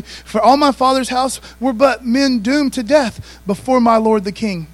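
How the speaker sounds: loud, slow proclaiming of the verse; preached scripture. Acted. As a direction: pastor rereading the verse to the church, slow and loud.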